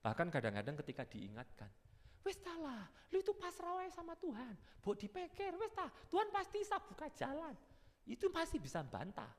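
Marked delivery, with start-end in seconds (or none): 2.2-9.3 pious friend. Pretend. Friend urging the believer to surrender, high and coaxing. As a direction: coax like a preachy friend. Friend voiced giving advice.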